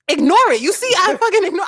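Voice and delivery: high, loud, fed-up quote; retold exasperation. Retelling being mad at a friend's act, exasperated. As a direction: snap it at someone missing the point. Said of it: "I was so mad at Kieja".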